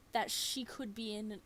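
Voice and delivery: usual speaking voice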